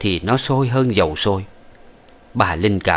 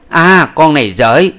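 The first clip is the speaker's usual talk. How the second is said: loud, high, slow outraged shout; 7 semitones higher, 9 dB louder, slower than usual. Acted. Old woman shouting at the concubine who turned away, loud and high. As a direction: bellow it in outrage. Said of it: "Bà cai hét lên một tiếng".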